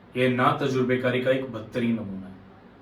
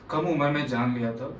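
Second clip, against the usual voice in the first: ordinary talk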